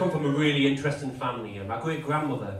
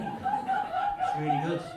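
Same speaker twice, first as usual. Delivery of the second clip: sarcastic; much slower than usual. Acted. Mock praise of subtitles.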